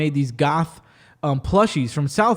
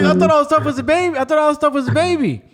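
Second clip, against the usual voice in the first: high panicked protest; 9 semitones higher, faster than usual. Retold or imitated. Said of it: "I was like".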